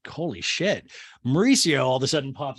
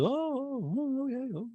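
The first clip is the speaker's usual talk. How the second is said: Mauricio; 10 semitones higher, 5 dB quieter than usual. Retold or imitated. Podcaster mimicking Mauricio pretending to listen, crooned ohs. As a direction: croon mock acknowledgments. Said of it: "He always makes a sound".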